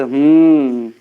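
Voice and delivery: loud, drawn-out mock hmm; imitated curt hmm. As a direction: imitate a friend's grumpy hmm, falling at the end. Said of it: "Saurabh भाई बोले".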